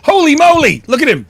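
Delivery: loud, high burst of surprise; excited. Real, not acted. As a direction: shout it out in delight. Friend appears on stream.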